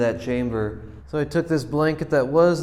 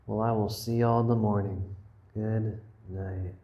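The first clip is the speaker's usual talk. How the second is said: nervous night vlog whisper; 6 semitones lower, 7 dB quieter than usual. Real, not acted. Saying goodnight in the van at night.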